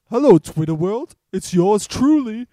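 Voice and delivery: high, loud greeting with a stretched ending; imitated catchphrase. Friend quoting his catchphrase to a co-host, mock-grand impression. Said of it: "he has this catchphrase".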